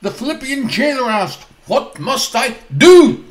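stern preacher's retort; glaring retort. Acted. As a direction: snap back with scripture like a stern doctor. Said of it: "he glares at them".

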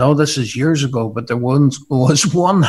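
usual speaking voice

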